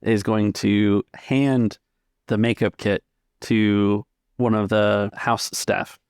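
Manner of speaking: ordinary delivery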